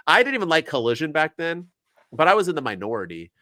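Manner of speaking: ordinary delivery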